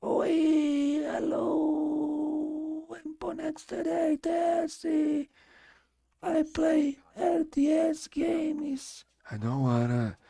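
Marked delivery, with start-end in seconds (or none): 0.0-9.1 very high, thin squeaky voice after surgery; mock a ruined high-pitched voice, ending in a whisper; squeaky falsetto caricature of his own voice. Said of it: "leave my voice like".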